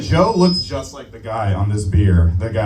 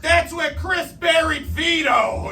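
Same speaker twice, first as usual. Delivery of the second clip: high, slow hammed-up announcing; mock tour guide; slower than usual. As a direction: imitate an excited guide, stretching the words up high. Imitating a tour guide pointing.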